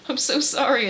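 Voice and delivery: mock-weepy sorry; tearful apology, retold. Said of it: "he's, like, crying".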